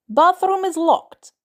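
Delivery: put-on high, loud and slow old woman's shout; a shouted warning through the locked door. A narrator's reading. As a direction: call it out sharply through the door. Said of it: "old woman shouts".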